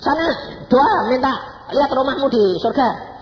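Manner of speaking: acted brisk command; instructing. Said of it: "dia bilang".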